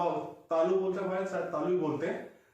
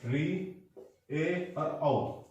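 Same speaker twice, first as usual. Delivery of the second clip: vowels spoken slowly one by one; slow recitation; much slower than usual. Shown as a demonstration. Teacher slowly reciting the vowel series for memorising. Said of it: "एक series याद होनी चाहिए".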